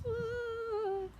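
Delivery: high mock wail; a quick hammed-up witch wail. Witch wailing as her hat blows off. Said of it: "how the witch wailed".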